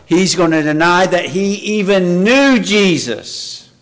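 swelling pulpit fervor; impassioned preaching. Acted. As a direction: preach it out with rising fire. Preaching rises to full force.

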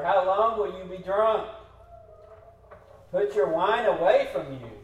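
acted rebuke, pushed out hard; upset priest. Priest scolds a woman he takes for drunk, loudly upset. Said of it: "He's upset".

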